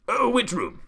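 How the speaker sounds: high, loud urgent snap; snapped. A narrator's reading. The Duke hearing of a burglar, snapping high and loud. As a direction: bark the question, alarmed. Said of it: "he snapped".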